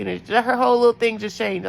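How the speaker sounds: normal talking voice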